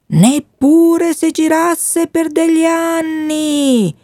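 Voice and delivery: high, slow, otherworldly wind voice; the talking wind. A narrator's reading. Wind gossiping in the treetops about the boy, high and slow. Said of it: "il vento che parlava".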